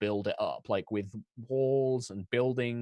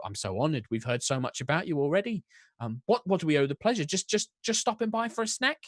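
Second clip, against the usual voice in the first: higher Cockney, flustered and fast; Cockney baker introduction; 8 semitones higher, 3 dB louder than usual. Acted. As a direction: introduce herself nervously in Cockney. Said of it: "English accents".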